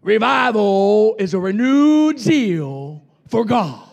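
slow, loud, weighty pronouncement of the preacher's words; Marlando's words. Retold or imitated. Retelling what Marlando told the revival, slow and booming. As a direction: drawl it out like a visiting evangelist. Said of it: "and Marlando said".

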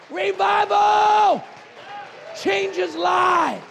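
fired-up pulpit preaching; preaching at full force. Real, not acted. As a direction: drive it home to the congregation. Preaching revival to the church.

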